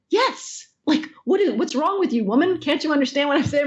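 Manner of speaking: impatient, talking down to her; exasperated fan. Retold or imitated. Fan getting impatient with the actress who forgot the lines, mock exasperated. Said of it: "He said".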